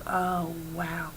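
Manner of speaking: very soft, understated awe; retold shock, voice dropped right down; stunned shock. Storyteller staring at the broken bulb, stunned shock. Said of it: "like, in shock".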